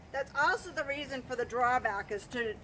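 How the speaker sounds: usual speaking voice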